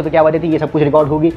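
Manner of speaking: normal talking voice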